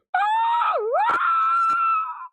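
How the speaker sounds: very high loud cackle; shrieking laugh. Real, not acted. Streamer laughing at being too loud, shrieking cackle. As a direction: let out a piercing laugh. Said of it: "Was I too loud".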